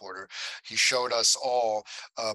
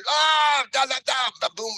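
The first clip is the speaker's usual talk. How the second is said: sudden shout, much higher and louder; yelled outburst; 8 dB louder than usual. Retold or imitated. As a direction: blurt it out as a loud cry. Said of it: "organically, I just went".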